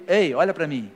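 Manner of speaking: ordinary delivery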